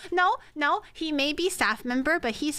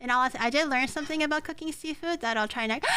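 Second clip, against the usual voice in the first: ordinary delivery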